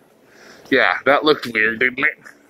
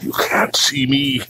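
whispering. Pretend. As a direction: whisper like a sneaky ninja. Ninja whisper.

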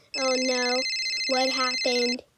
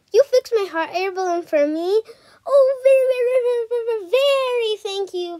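overjoyed thanks; 8 semitones higher, 6 dB louder, faster than usual. Acted. Balloon fixed, overjoyed.

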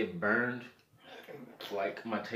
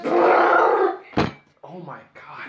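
pained drawn-out cry; 12 dB louder, much slower than usual. Real, not acted. Mouth burning from pepper.

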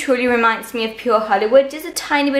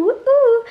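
much higher happy whoop; high woo-hoo cheer; 12 semitones higher, 3 dB louder than usual. Real, not acted. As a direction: whoop for joy.

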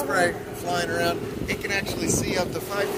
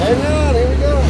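raised, higher voice over the engine; real driver's shout of warning; calling out; 7 semitones higher, 10 dB louder than usual. Real, not acted. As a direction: call a loud heads-up to passengers. Driver calls out entering the lake.

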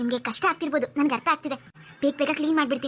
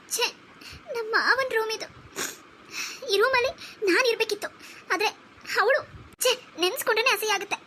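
put-on jealous, upset girl; 6 semitones higher than usual. Jealous girl in her uncle's room.

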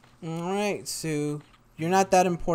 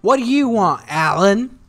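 calling out; 3 semitones higher, 7 dB louder than usual. Real, not acted. Calling out to customer.

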